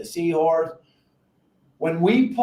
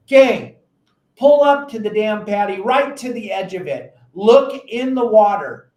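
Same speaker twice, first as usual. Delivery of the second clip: louder, higher push on the key advice; emphatic call; 3 semitones higher, 6 dB louder than usual. Real, not acted. Seminar speaker telling anglers to just pull up, loudly. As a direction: snap the audience out of it. Instructor cuts through with blunt advice.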